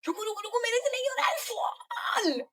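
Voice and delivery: high, frantic cry to stop the horse; retold panicked scream, pitched high; panicked yell. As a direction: shriek it like you're about to fall. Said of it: "मैंने जोर से चिल्लाया".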